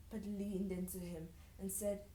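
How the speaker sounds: ordinary delivery, nothing marked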